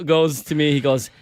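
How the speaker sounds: ordinary delivery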